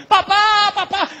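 put-on high, loud child's desperate cry. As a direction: call out to papa from the altar, desperate. Isaac calls from the altar.

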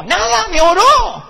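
high, slow, drawn-out laughing lament; laughing complaint. Real, not acted. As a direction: stretch it out in comic despair. Telling wife he got only prayer. (queja lenta y aguda entre risas)

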